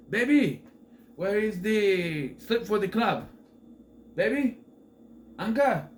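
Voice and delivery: loud, high calls to someone in another room; calling out. Retold or imitated. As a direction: call out for your girlfriend, acted out.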